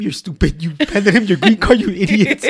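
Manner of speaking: high, loud imitation of a scolding mother, cracking up; laughing mock scolding. Mom berating son over green card, laughing mock scolding.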